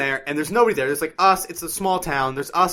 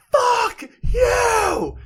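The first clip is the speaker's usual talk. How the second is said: very high, drawn-out imitated scream; hammed-up rage, pitched way up; mock enraged scream. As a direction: screech it like a furious old biker. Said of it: "what are you angry about".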